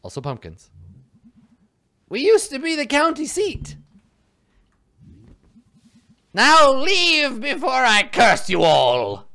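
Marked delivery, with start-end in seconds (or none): 2.1-9.3 high cackling witch threat; silly witch voice. Acted. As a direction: play a silly witch casting curses. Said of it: "silly witch voice".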